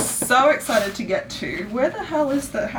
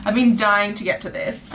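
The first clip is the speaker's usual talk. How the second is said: ordinary talk, nothing marked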